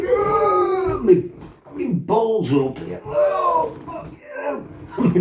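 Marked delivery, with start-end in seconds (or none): 0.0-1.0 high strangled cry of pain. Retold or imitated. Kicked in the balls by his brother, retold pained yelp. 3.0-4.6 high strained groan that sinks to almost nothing; pained groan. Retold or imitated. Lying on the floor after the kick, high pained groan.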